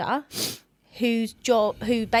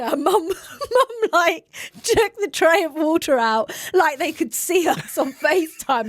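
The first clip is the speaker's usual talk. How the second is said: laughing; 7 semitones higher, 5 dB louder than usual. Real, not acted. Laughing while telling the story.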